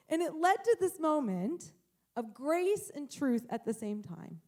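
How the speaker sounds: normal talking voice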